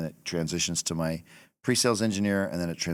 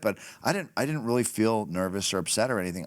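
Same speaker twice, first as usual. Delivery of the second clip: usual speaking voice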